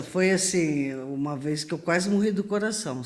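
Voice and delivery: normal talking voice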